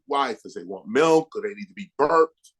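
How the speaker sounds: normal talking voice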